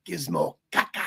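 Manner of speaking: low, soft put-on creature voice; quoted movie creature. Fan quoting favorite Gremlins line, low creature voice. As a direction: do a little film monster's line. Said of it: "my favorite line from both the Gremlins movies".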